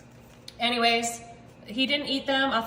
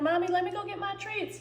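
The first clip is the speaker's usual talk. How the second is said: high, soft voice for a pet; little dog's voice; 7 semitones higher, 5 dB quieter than usual. Acted. Dog asking mommy for treats, high soft pet voice. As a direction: speak for a little dog begging. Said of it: "He's like".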